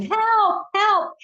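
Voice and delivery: high desperate cry of help from the water; calling for help. Retold or imitated. Drowning boy shouting for help from the river. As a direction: yell for help like someone drowning.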